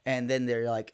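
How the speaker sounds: ordinary talk, nothing marked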